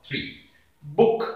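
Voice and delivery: usual speaking voice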